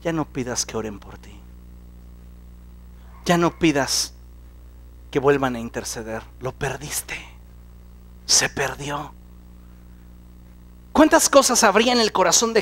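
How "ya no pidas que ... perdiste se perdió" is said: much lower and softer, menacing; devil's taunt. Retold or imitated. The devil discouraging the believer, low menacing murmur. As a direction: murmur it like a tempter. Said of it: "Viene el diablo y te dice".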